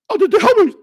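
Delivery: high, loud, strained mock whine of a flustered guy; mocking a mad fool. Streamer mocking a furious rival, high loud mock whine. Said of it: "that fool was so mad, he was like".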